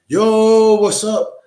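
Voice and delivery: high, loud, hammed-up street greeting; mock hustler greeting. Comic imitating a hustler greeting people in a room, high and loud. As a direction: toss it off like a hustler working the room.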